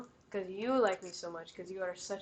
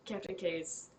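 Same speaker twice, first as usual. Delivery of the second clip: ordinary talk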